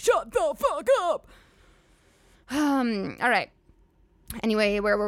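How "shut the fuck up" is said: mock outburst. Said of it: "You're like".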